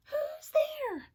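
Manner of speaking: high startled mouse voice; high mouse cry. Acted. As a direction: call out like a frightened little mouse. Mouse cries out in cave.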